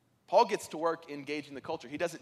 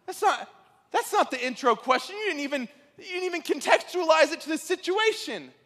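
higher, louder exasperated rant; vent, pitched up and indignant, half mocking himself; frustrated rant; 10 semitones higher, 6 dB louder than usual. Professor mocking his own frustration at the trucker, in a higher, louder exasperated voice. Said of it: "he was like".

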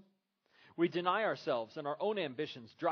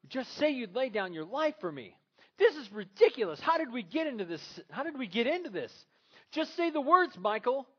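loud, much higher shouting wife; angry insistence; 9 semitones higher, 8 dB louder than usual. Retold or imitated. Gloria yelling at Michael to say it, loud and high. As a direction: shout at him to just say it.

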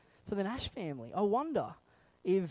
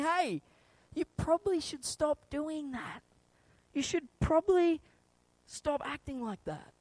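gentle nudging; 8 semitones higher than usual. Acted. God inside us advising against it, gentle nudging. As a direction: tactfully nudge them with pauses between phrases. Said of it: "And he's going".

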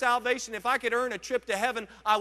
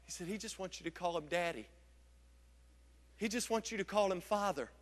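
much softer, intimate; hushed tender; 3 semitones lower, 10 dB quieter than usual. Acted. Pastor tells the church God wants to be called Daddy, hushed. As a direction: drop it to a hush, tenderly.

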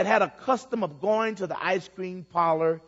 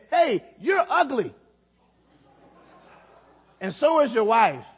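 mock parrot insult; 3 semitones higher, 4 dB louder than usual. A narrator's reading. Parrot insults the old man.

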